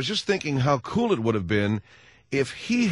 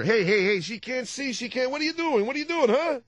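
high, exasperated hey hey; hammed-up cop teacher; Dennis Franz as teacher; 12 semitones higher than usual. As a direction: badger a deaf pupil. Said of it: "Dennis Franz is the, in the Miracle Worker".

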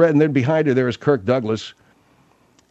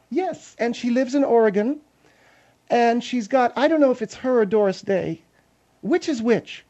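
higher woman's voice, self-assured; Margaret; 9 semitones higher than usual. Acted. Margaret telling Phil about Kim Novak, self-assured then unsure. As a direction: muse aloud as a higher-voiced lady.

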